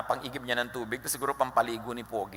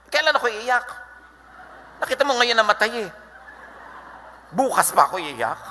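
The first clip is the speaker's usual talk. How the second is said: indignant retort; 9 semitones higher, 4 dB louder than usual. Retold or imitated. Grieving person answering comforter.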